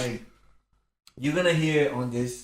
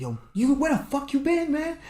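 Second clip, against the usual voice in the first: angry brother. Retold or imitated. Brother angrily confronting him.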